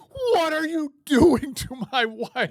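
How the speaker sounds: a high, loud, slow wailing outrage; hammed-up king's outrage. The king finding Haman on the queen, a hammed-up wailing outcry. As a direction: wail the king's horror over the top.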